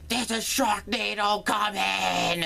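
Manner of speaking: cheesy movie doomsayer; mock warning. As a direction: cry out a dramatic warning. Limping man warns of disaster.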